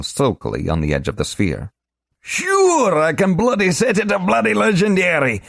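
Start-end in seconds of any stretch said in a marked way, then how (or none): 2.2-5.4 very high, loud scoffing retort. Acted. Globin scoffing at Korash's request, high and loud. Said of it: "he scoffed".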